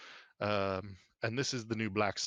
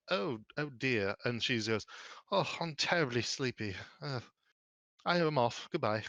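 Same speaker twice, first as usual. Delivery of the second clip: tipsy stepmother; 2 semitones higher than usual. Acted. Madam Kensington drops her wine and goes off, tipsily sleepy.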